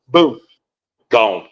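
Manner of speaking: ordinary talk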